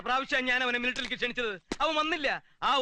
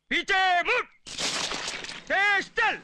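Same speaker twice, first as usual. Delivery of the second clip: drill sergeant; 6 semitones higher, much slower than usual. Acted. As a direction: shout drill orders. Captain drilling the men.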